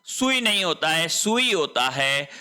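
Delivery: ordinary talk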